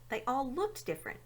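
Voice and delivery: ordinary delivery, nothing marked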